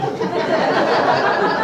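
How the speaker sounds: short high airy laugh; real quick giggle. Real, not acted. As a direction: chuckle at the absurd idea. Laughs at own joke.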